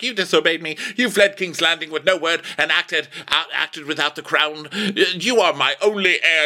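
high-pitched indignant scolding; mock-angry royal rebuke; pissy king. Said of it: "he's all pissy".

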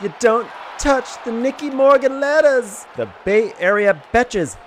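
high, bouncy sponsor roll call; sponsor shout-out read. Acted. Hosts thanking their sponsors, hammed-up cheery. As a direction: ham up each rhyming sponsor plug.

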